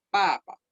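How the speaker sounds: "papa" falling on the first syllable; no puff of air on the p of "papa"; fourth tone, unaspirated. Shown as a demonstration. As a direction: drop the first "pa", keep the second short. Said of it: "Así se pronuncia".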